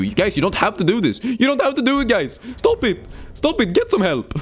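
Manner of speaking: high-pitched playful begging; mock pleading. Streamer urging viewers off achievements, mock pleading. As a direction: plead with rising comic desperation.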